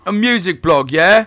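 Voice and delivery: loud, high mock British; mock British intro. Guest echoing the intro, loud mock British. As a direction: shout the intro back in a hammy British accent. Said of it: "start our intro".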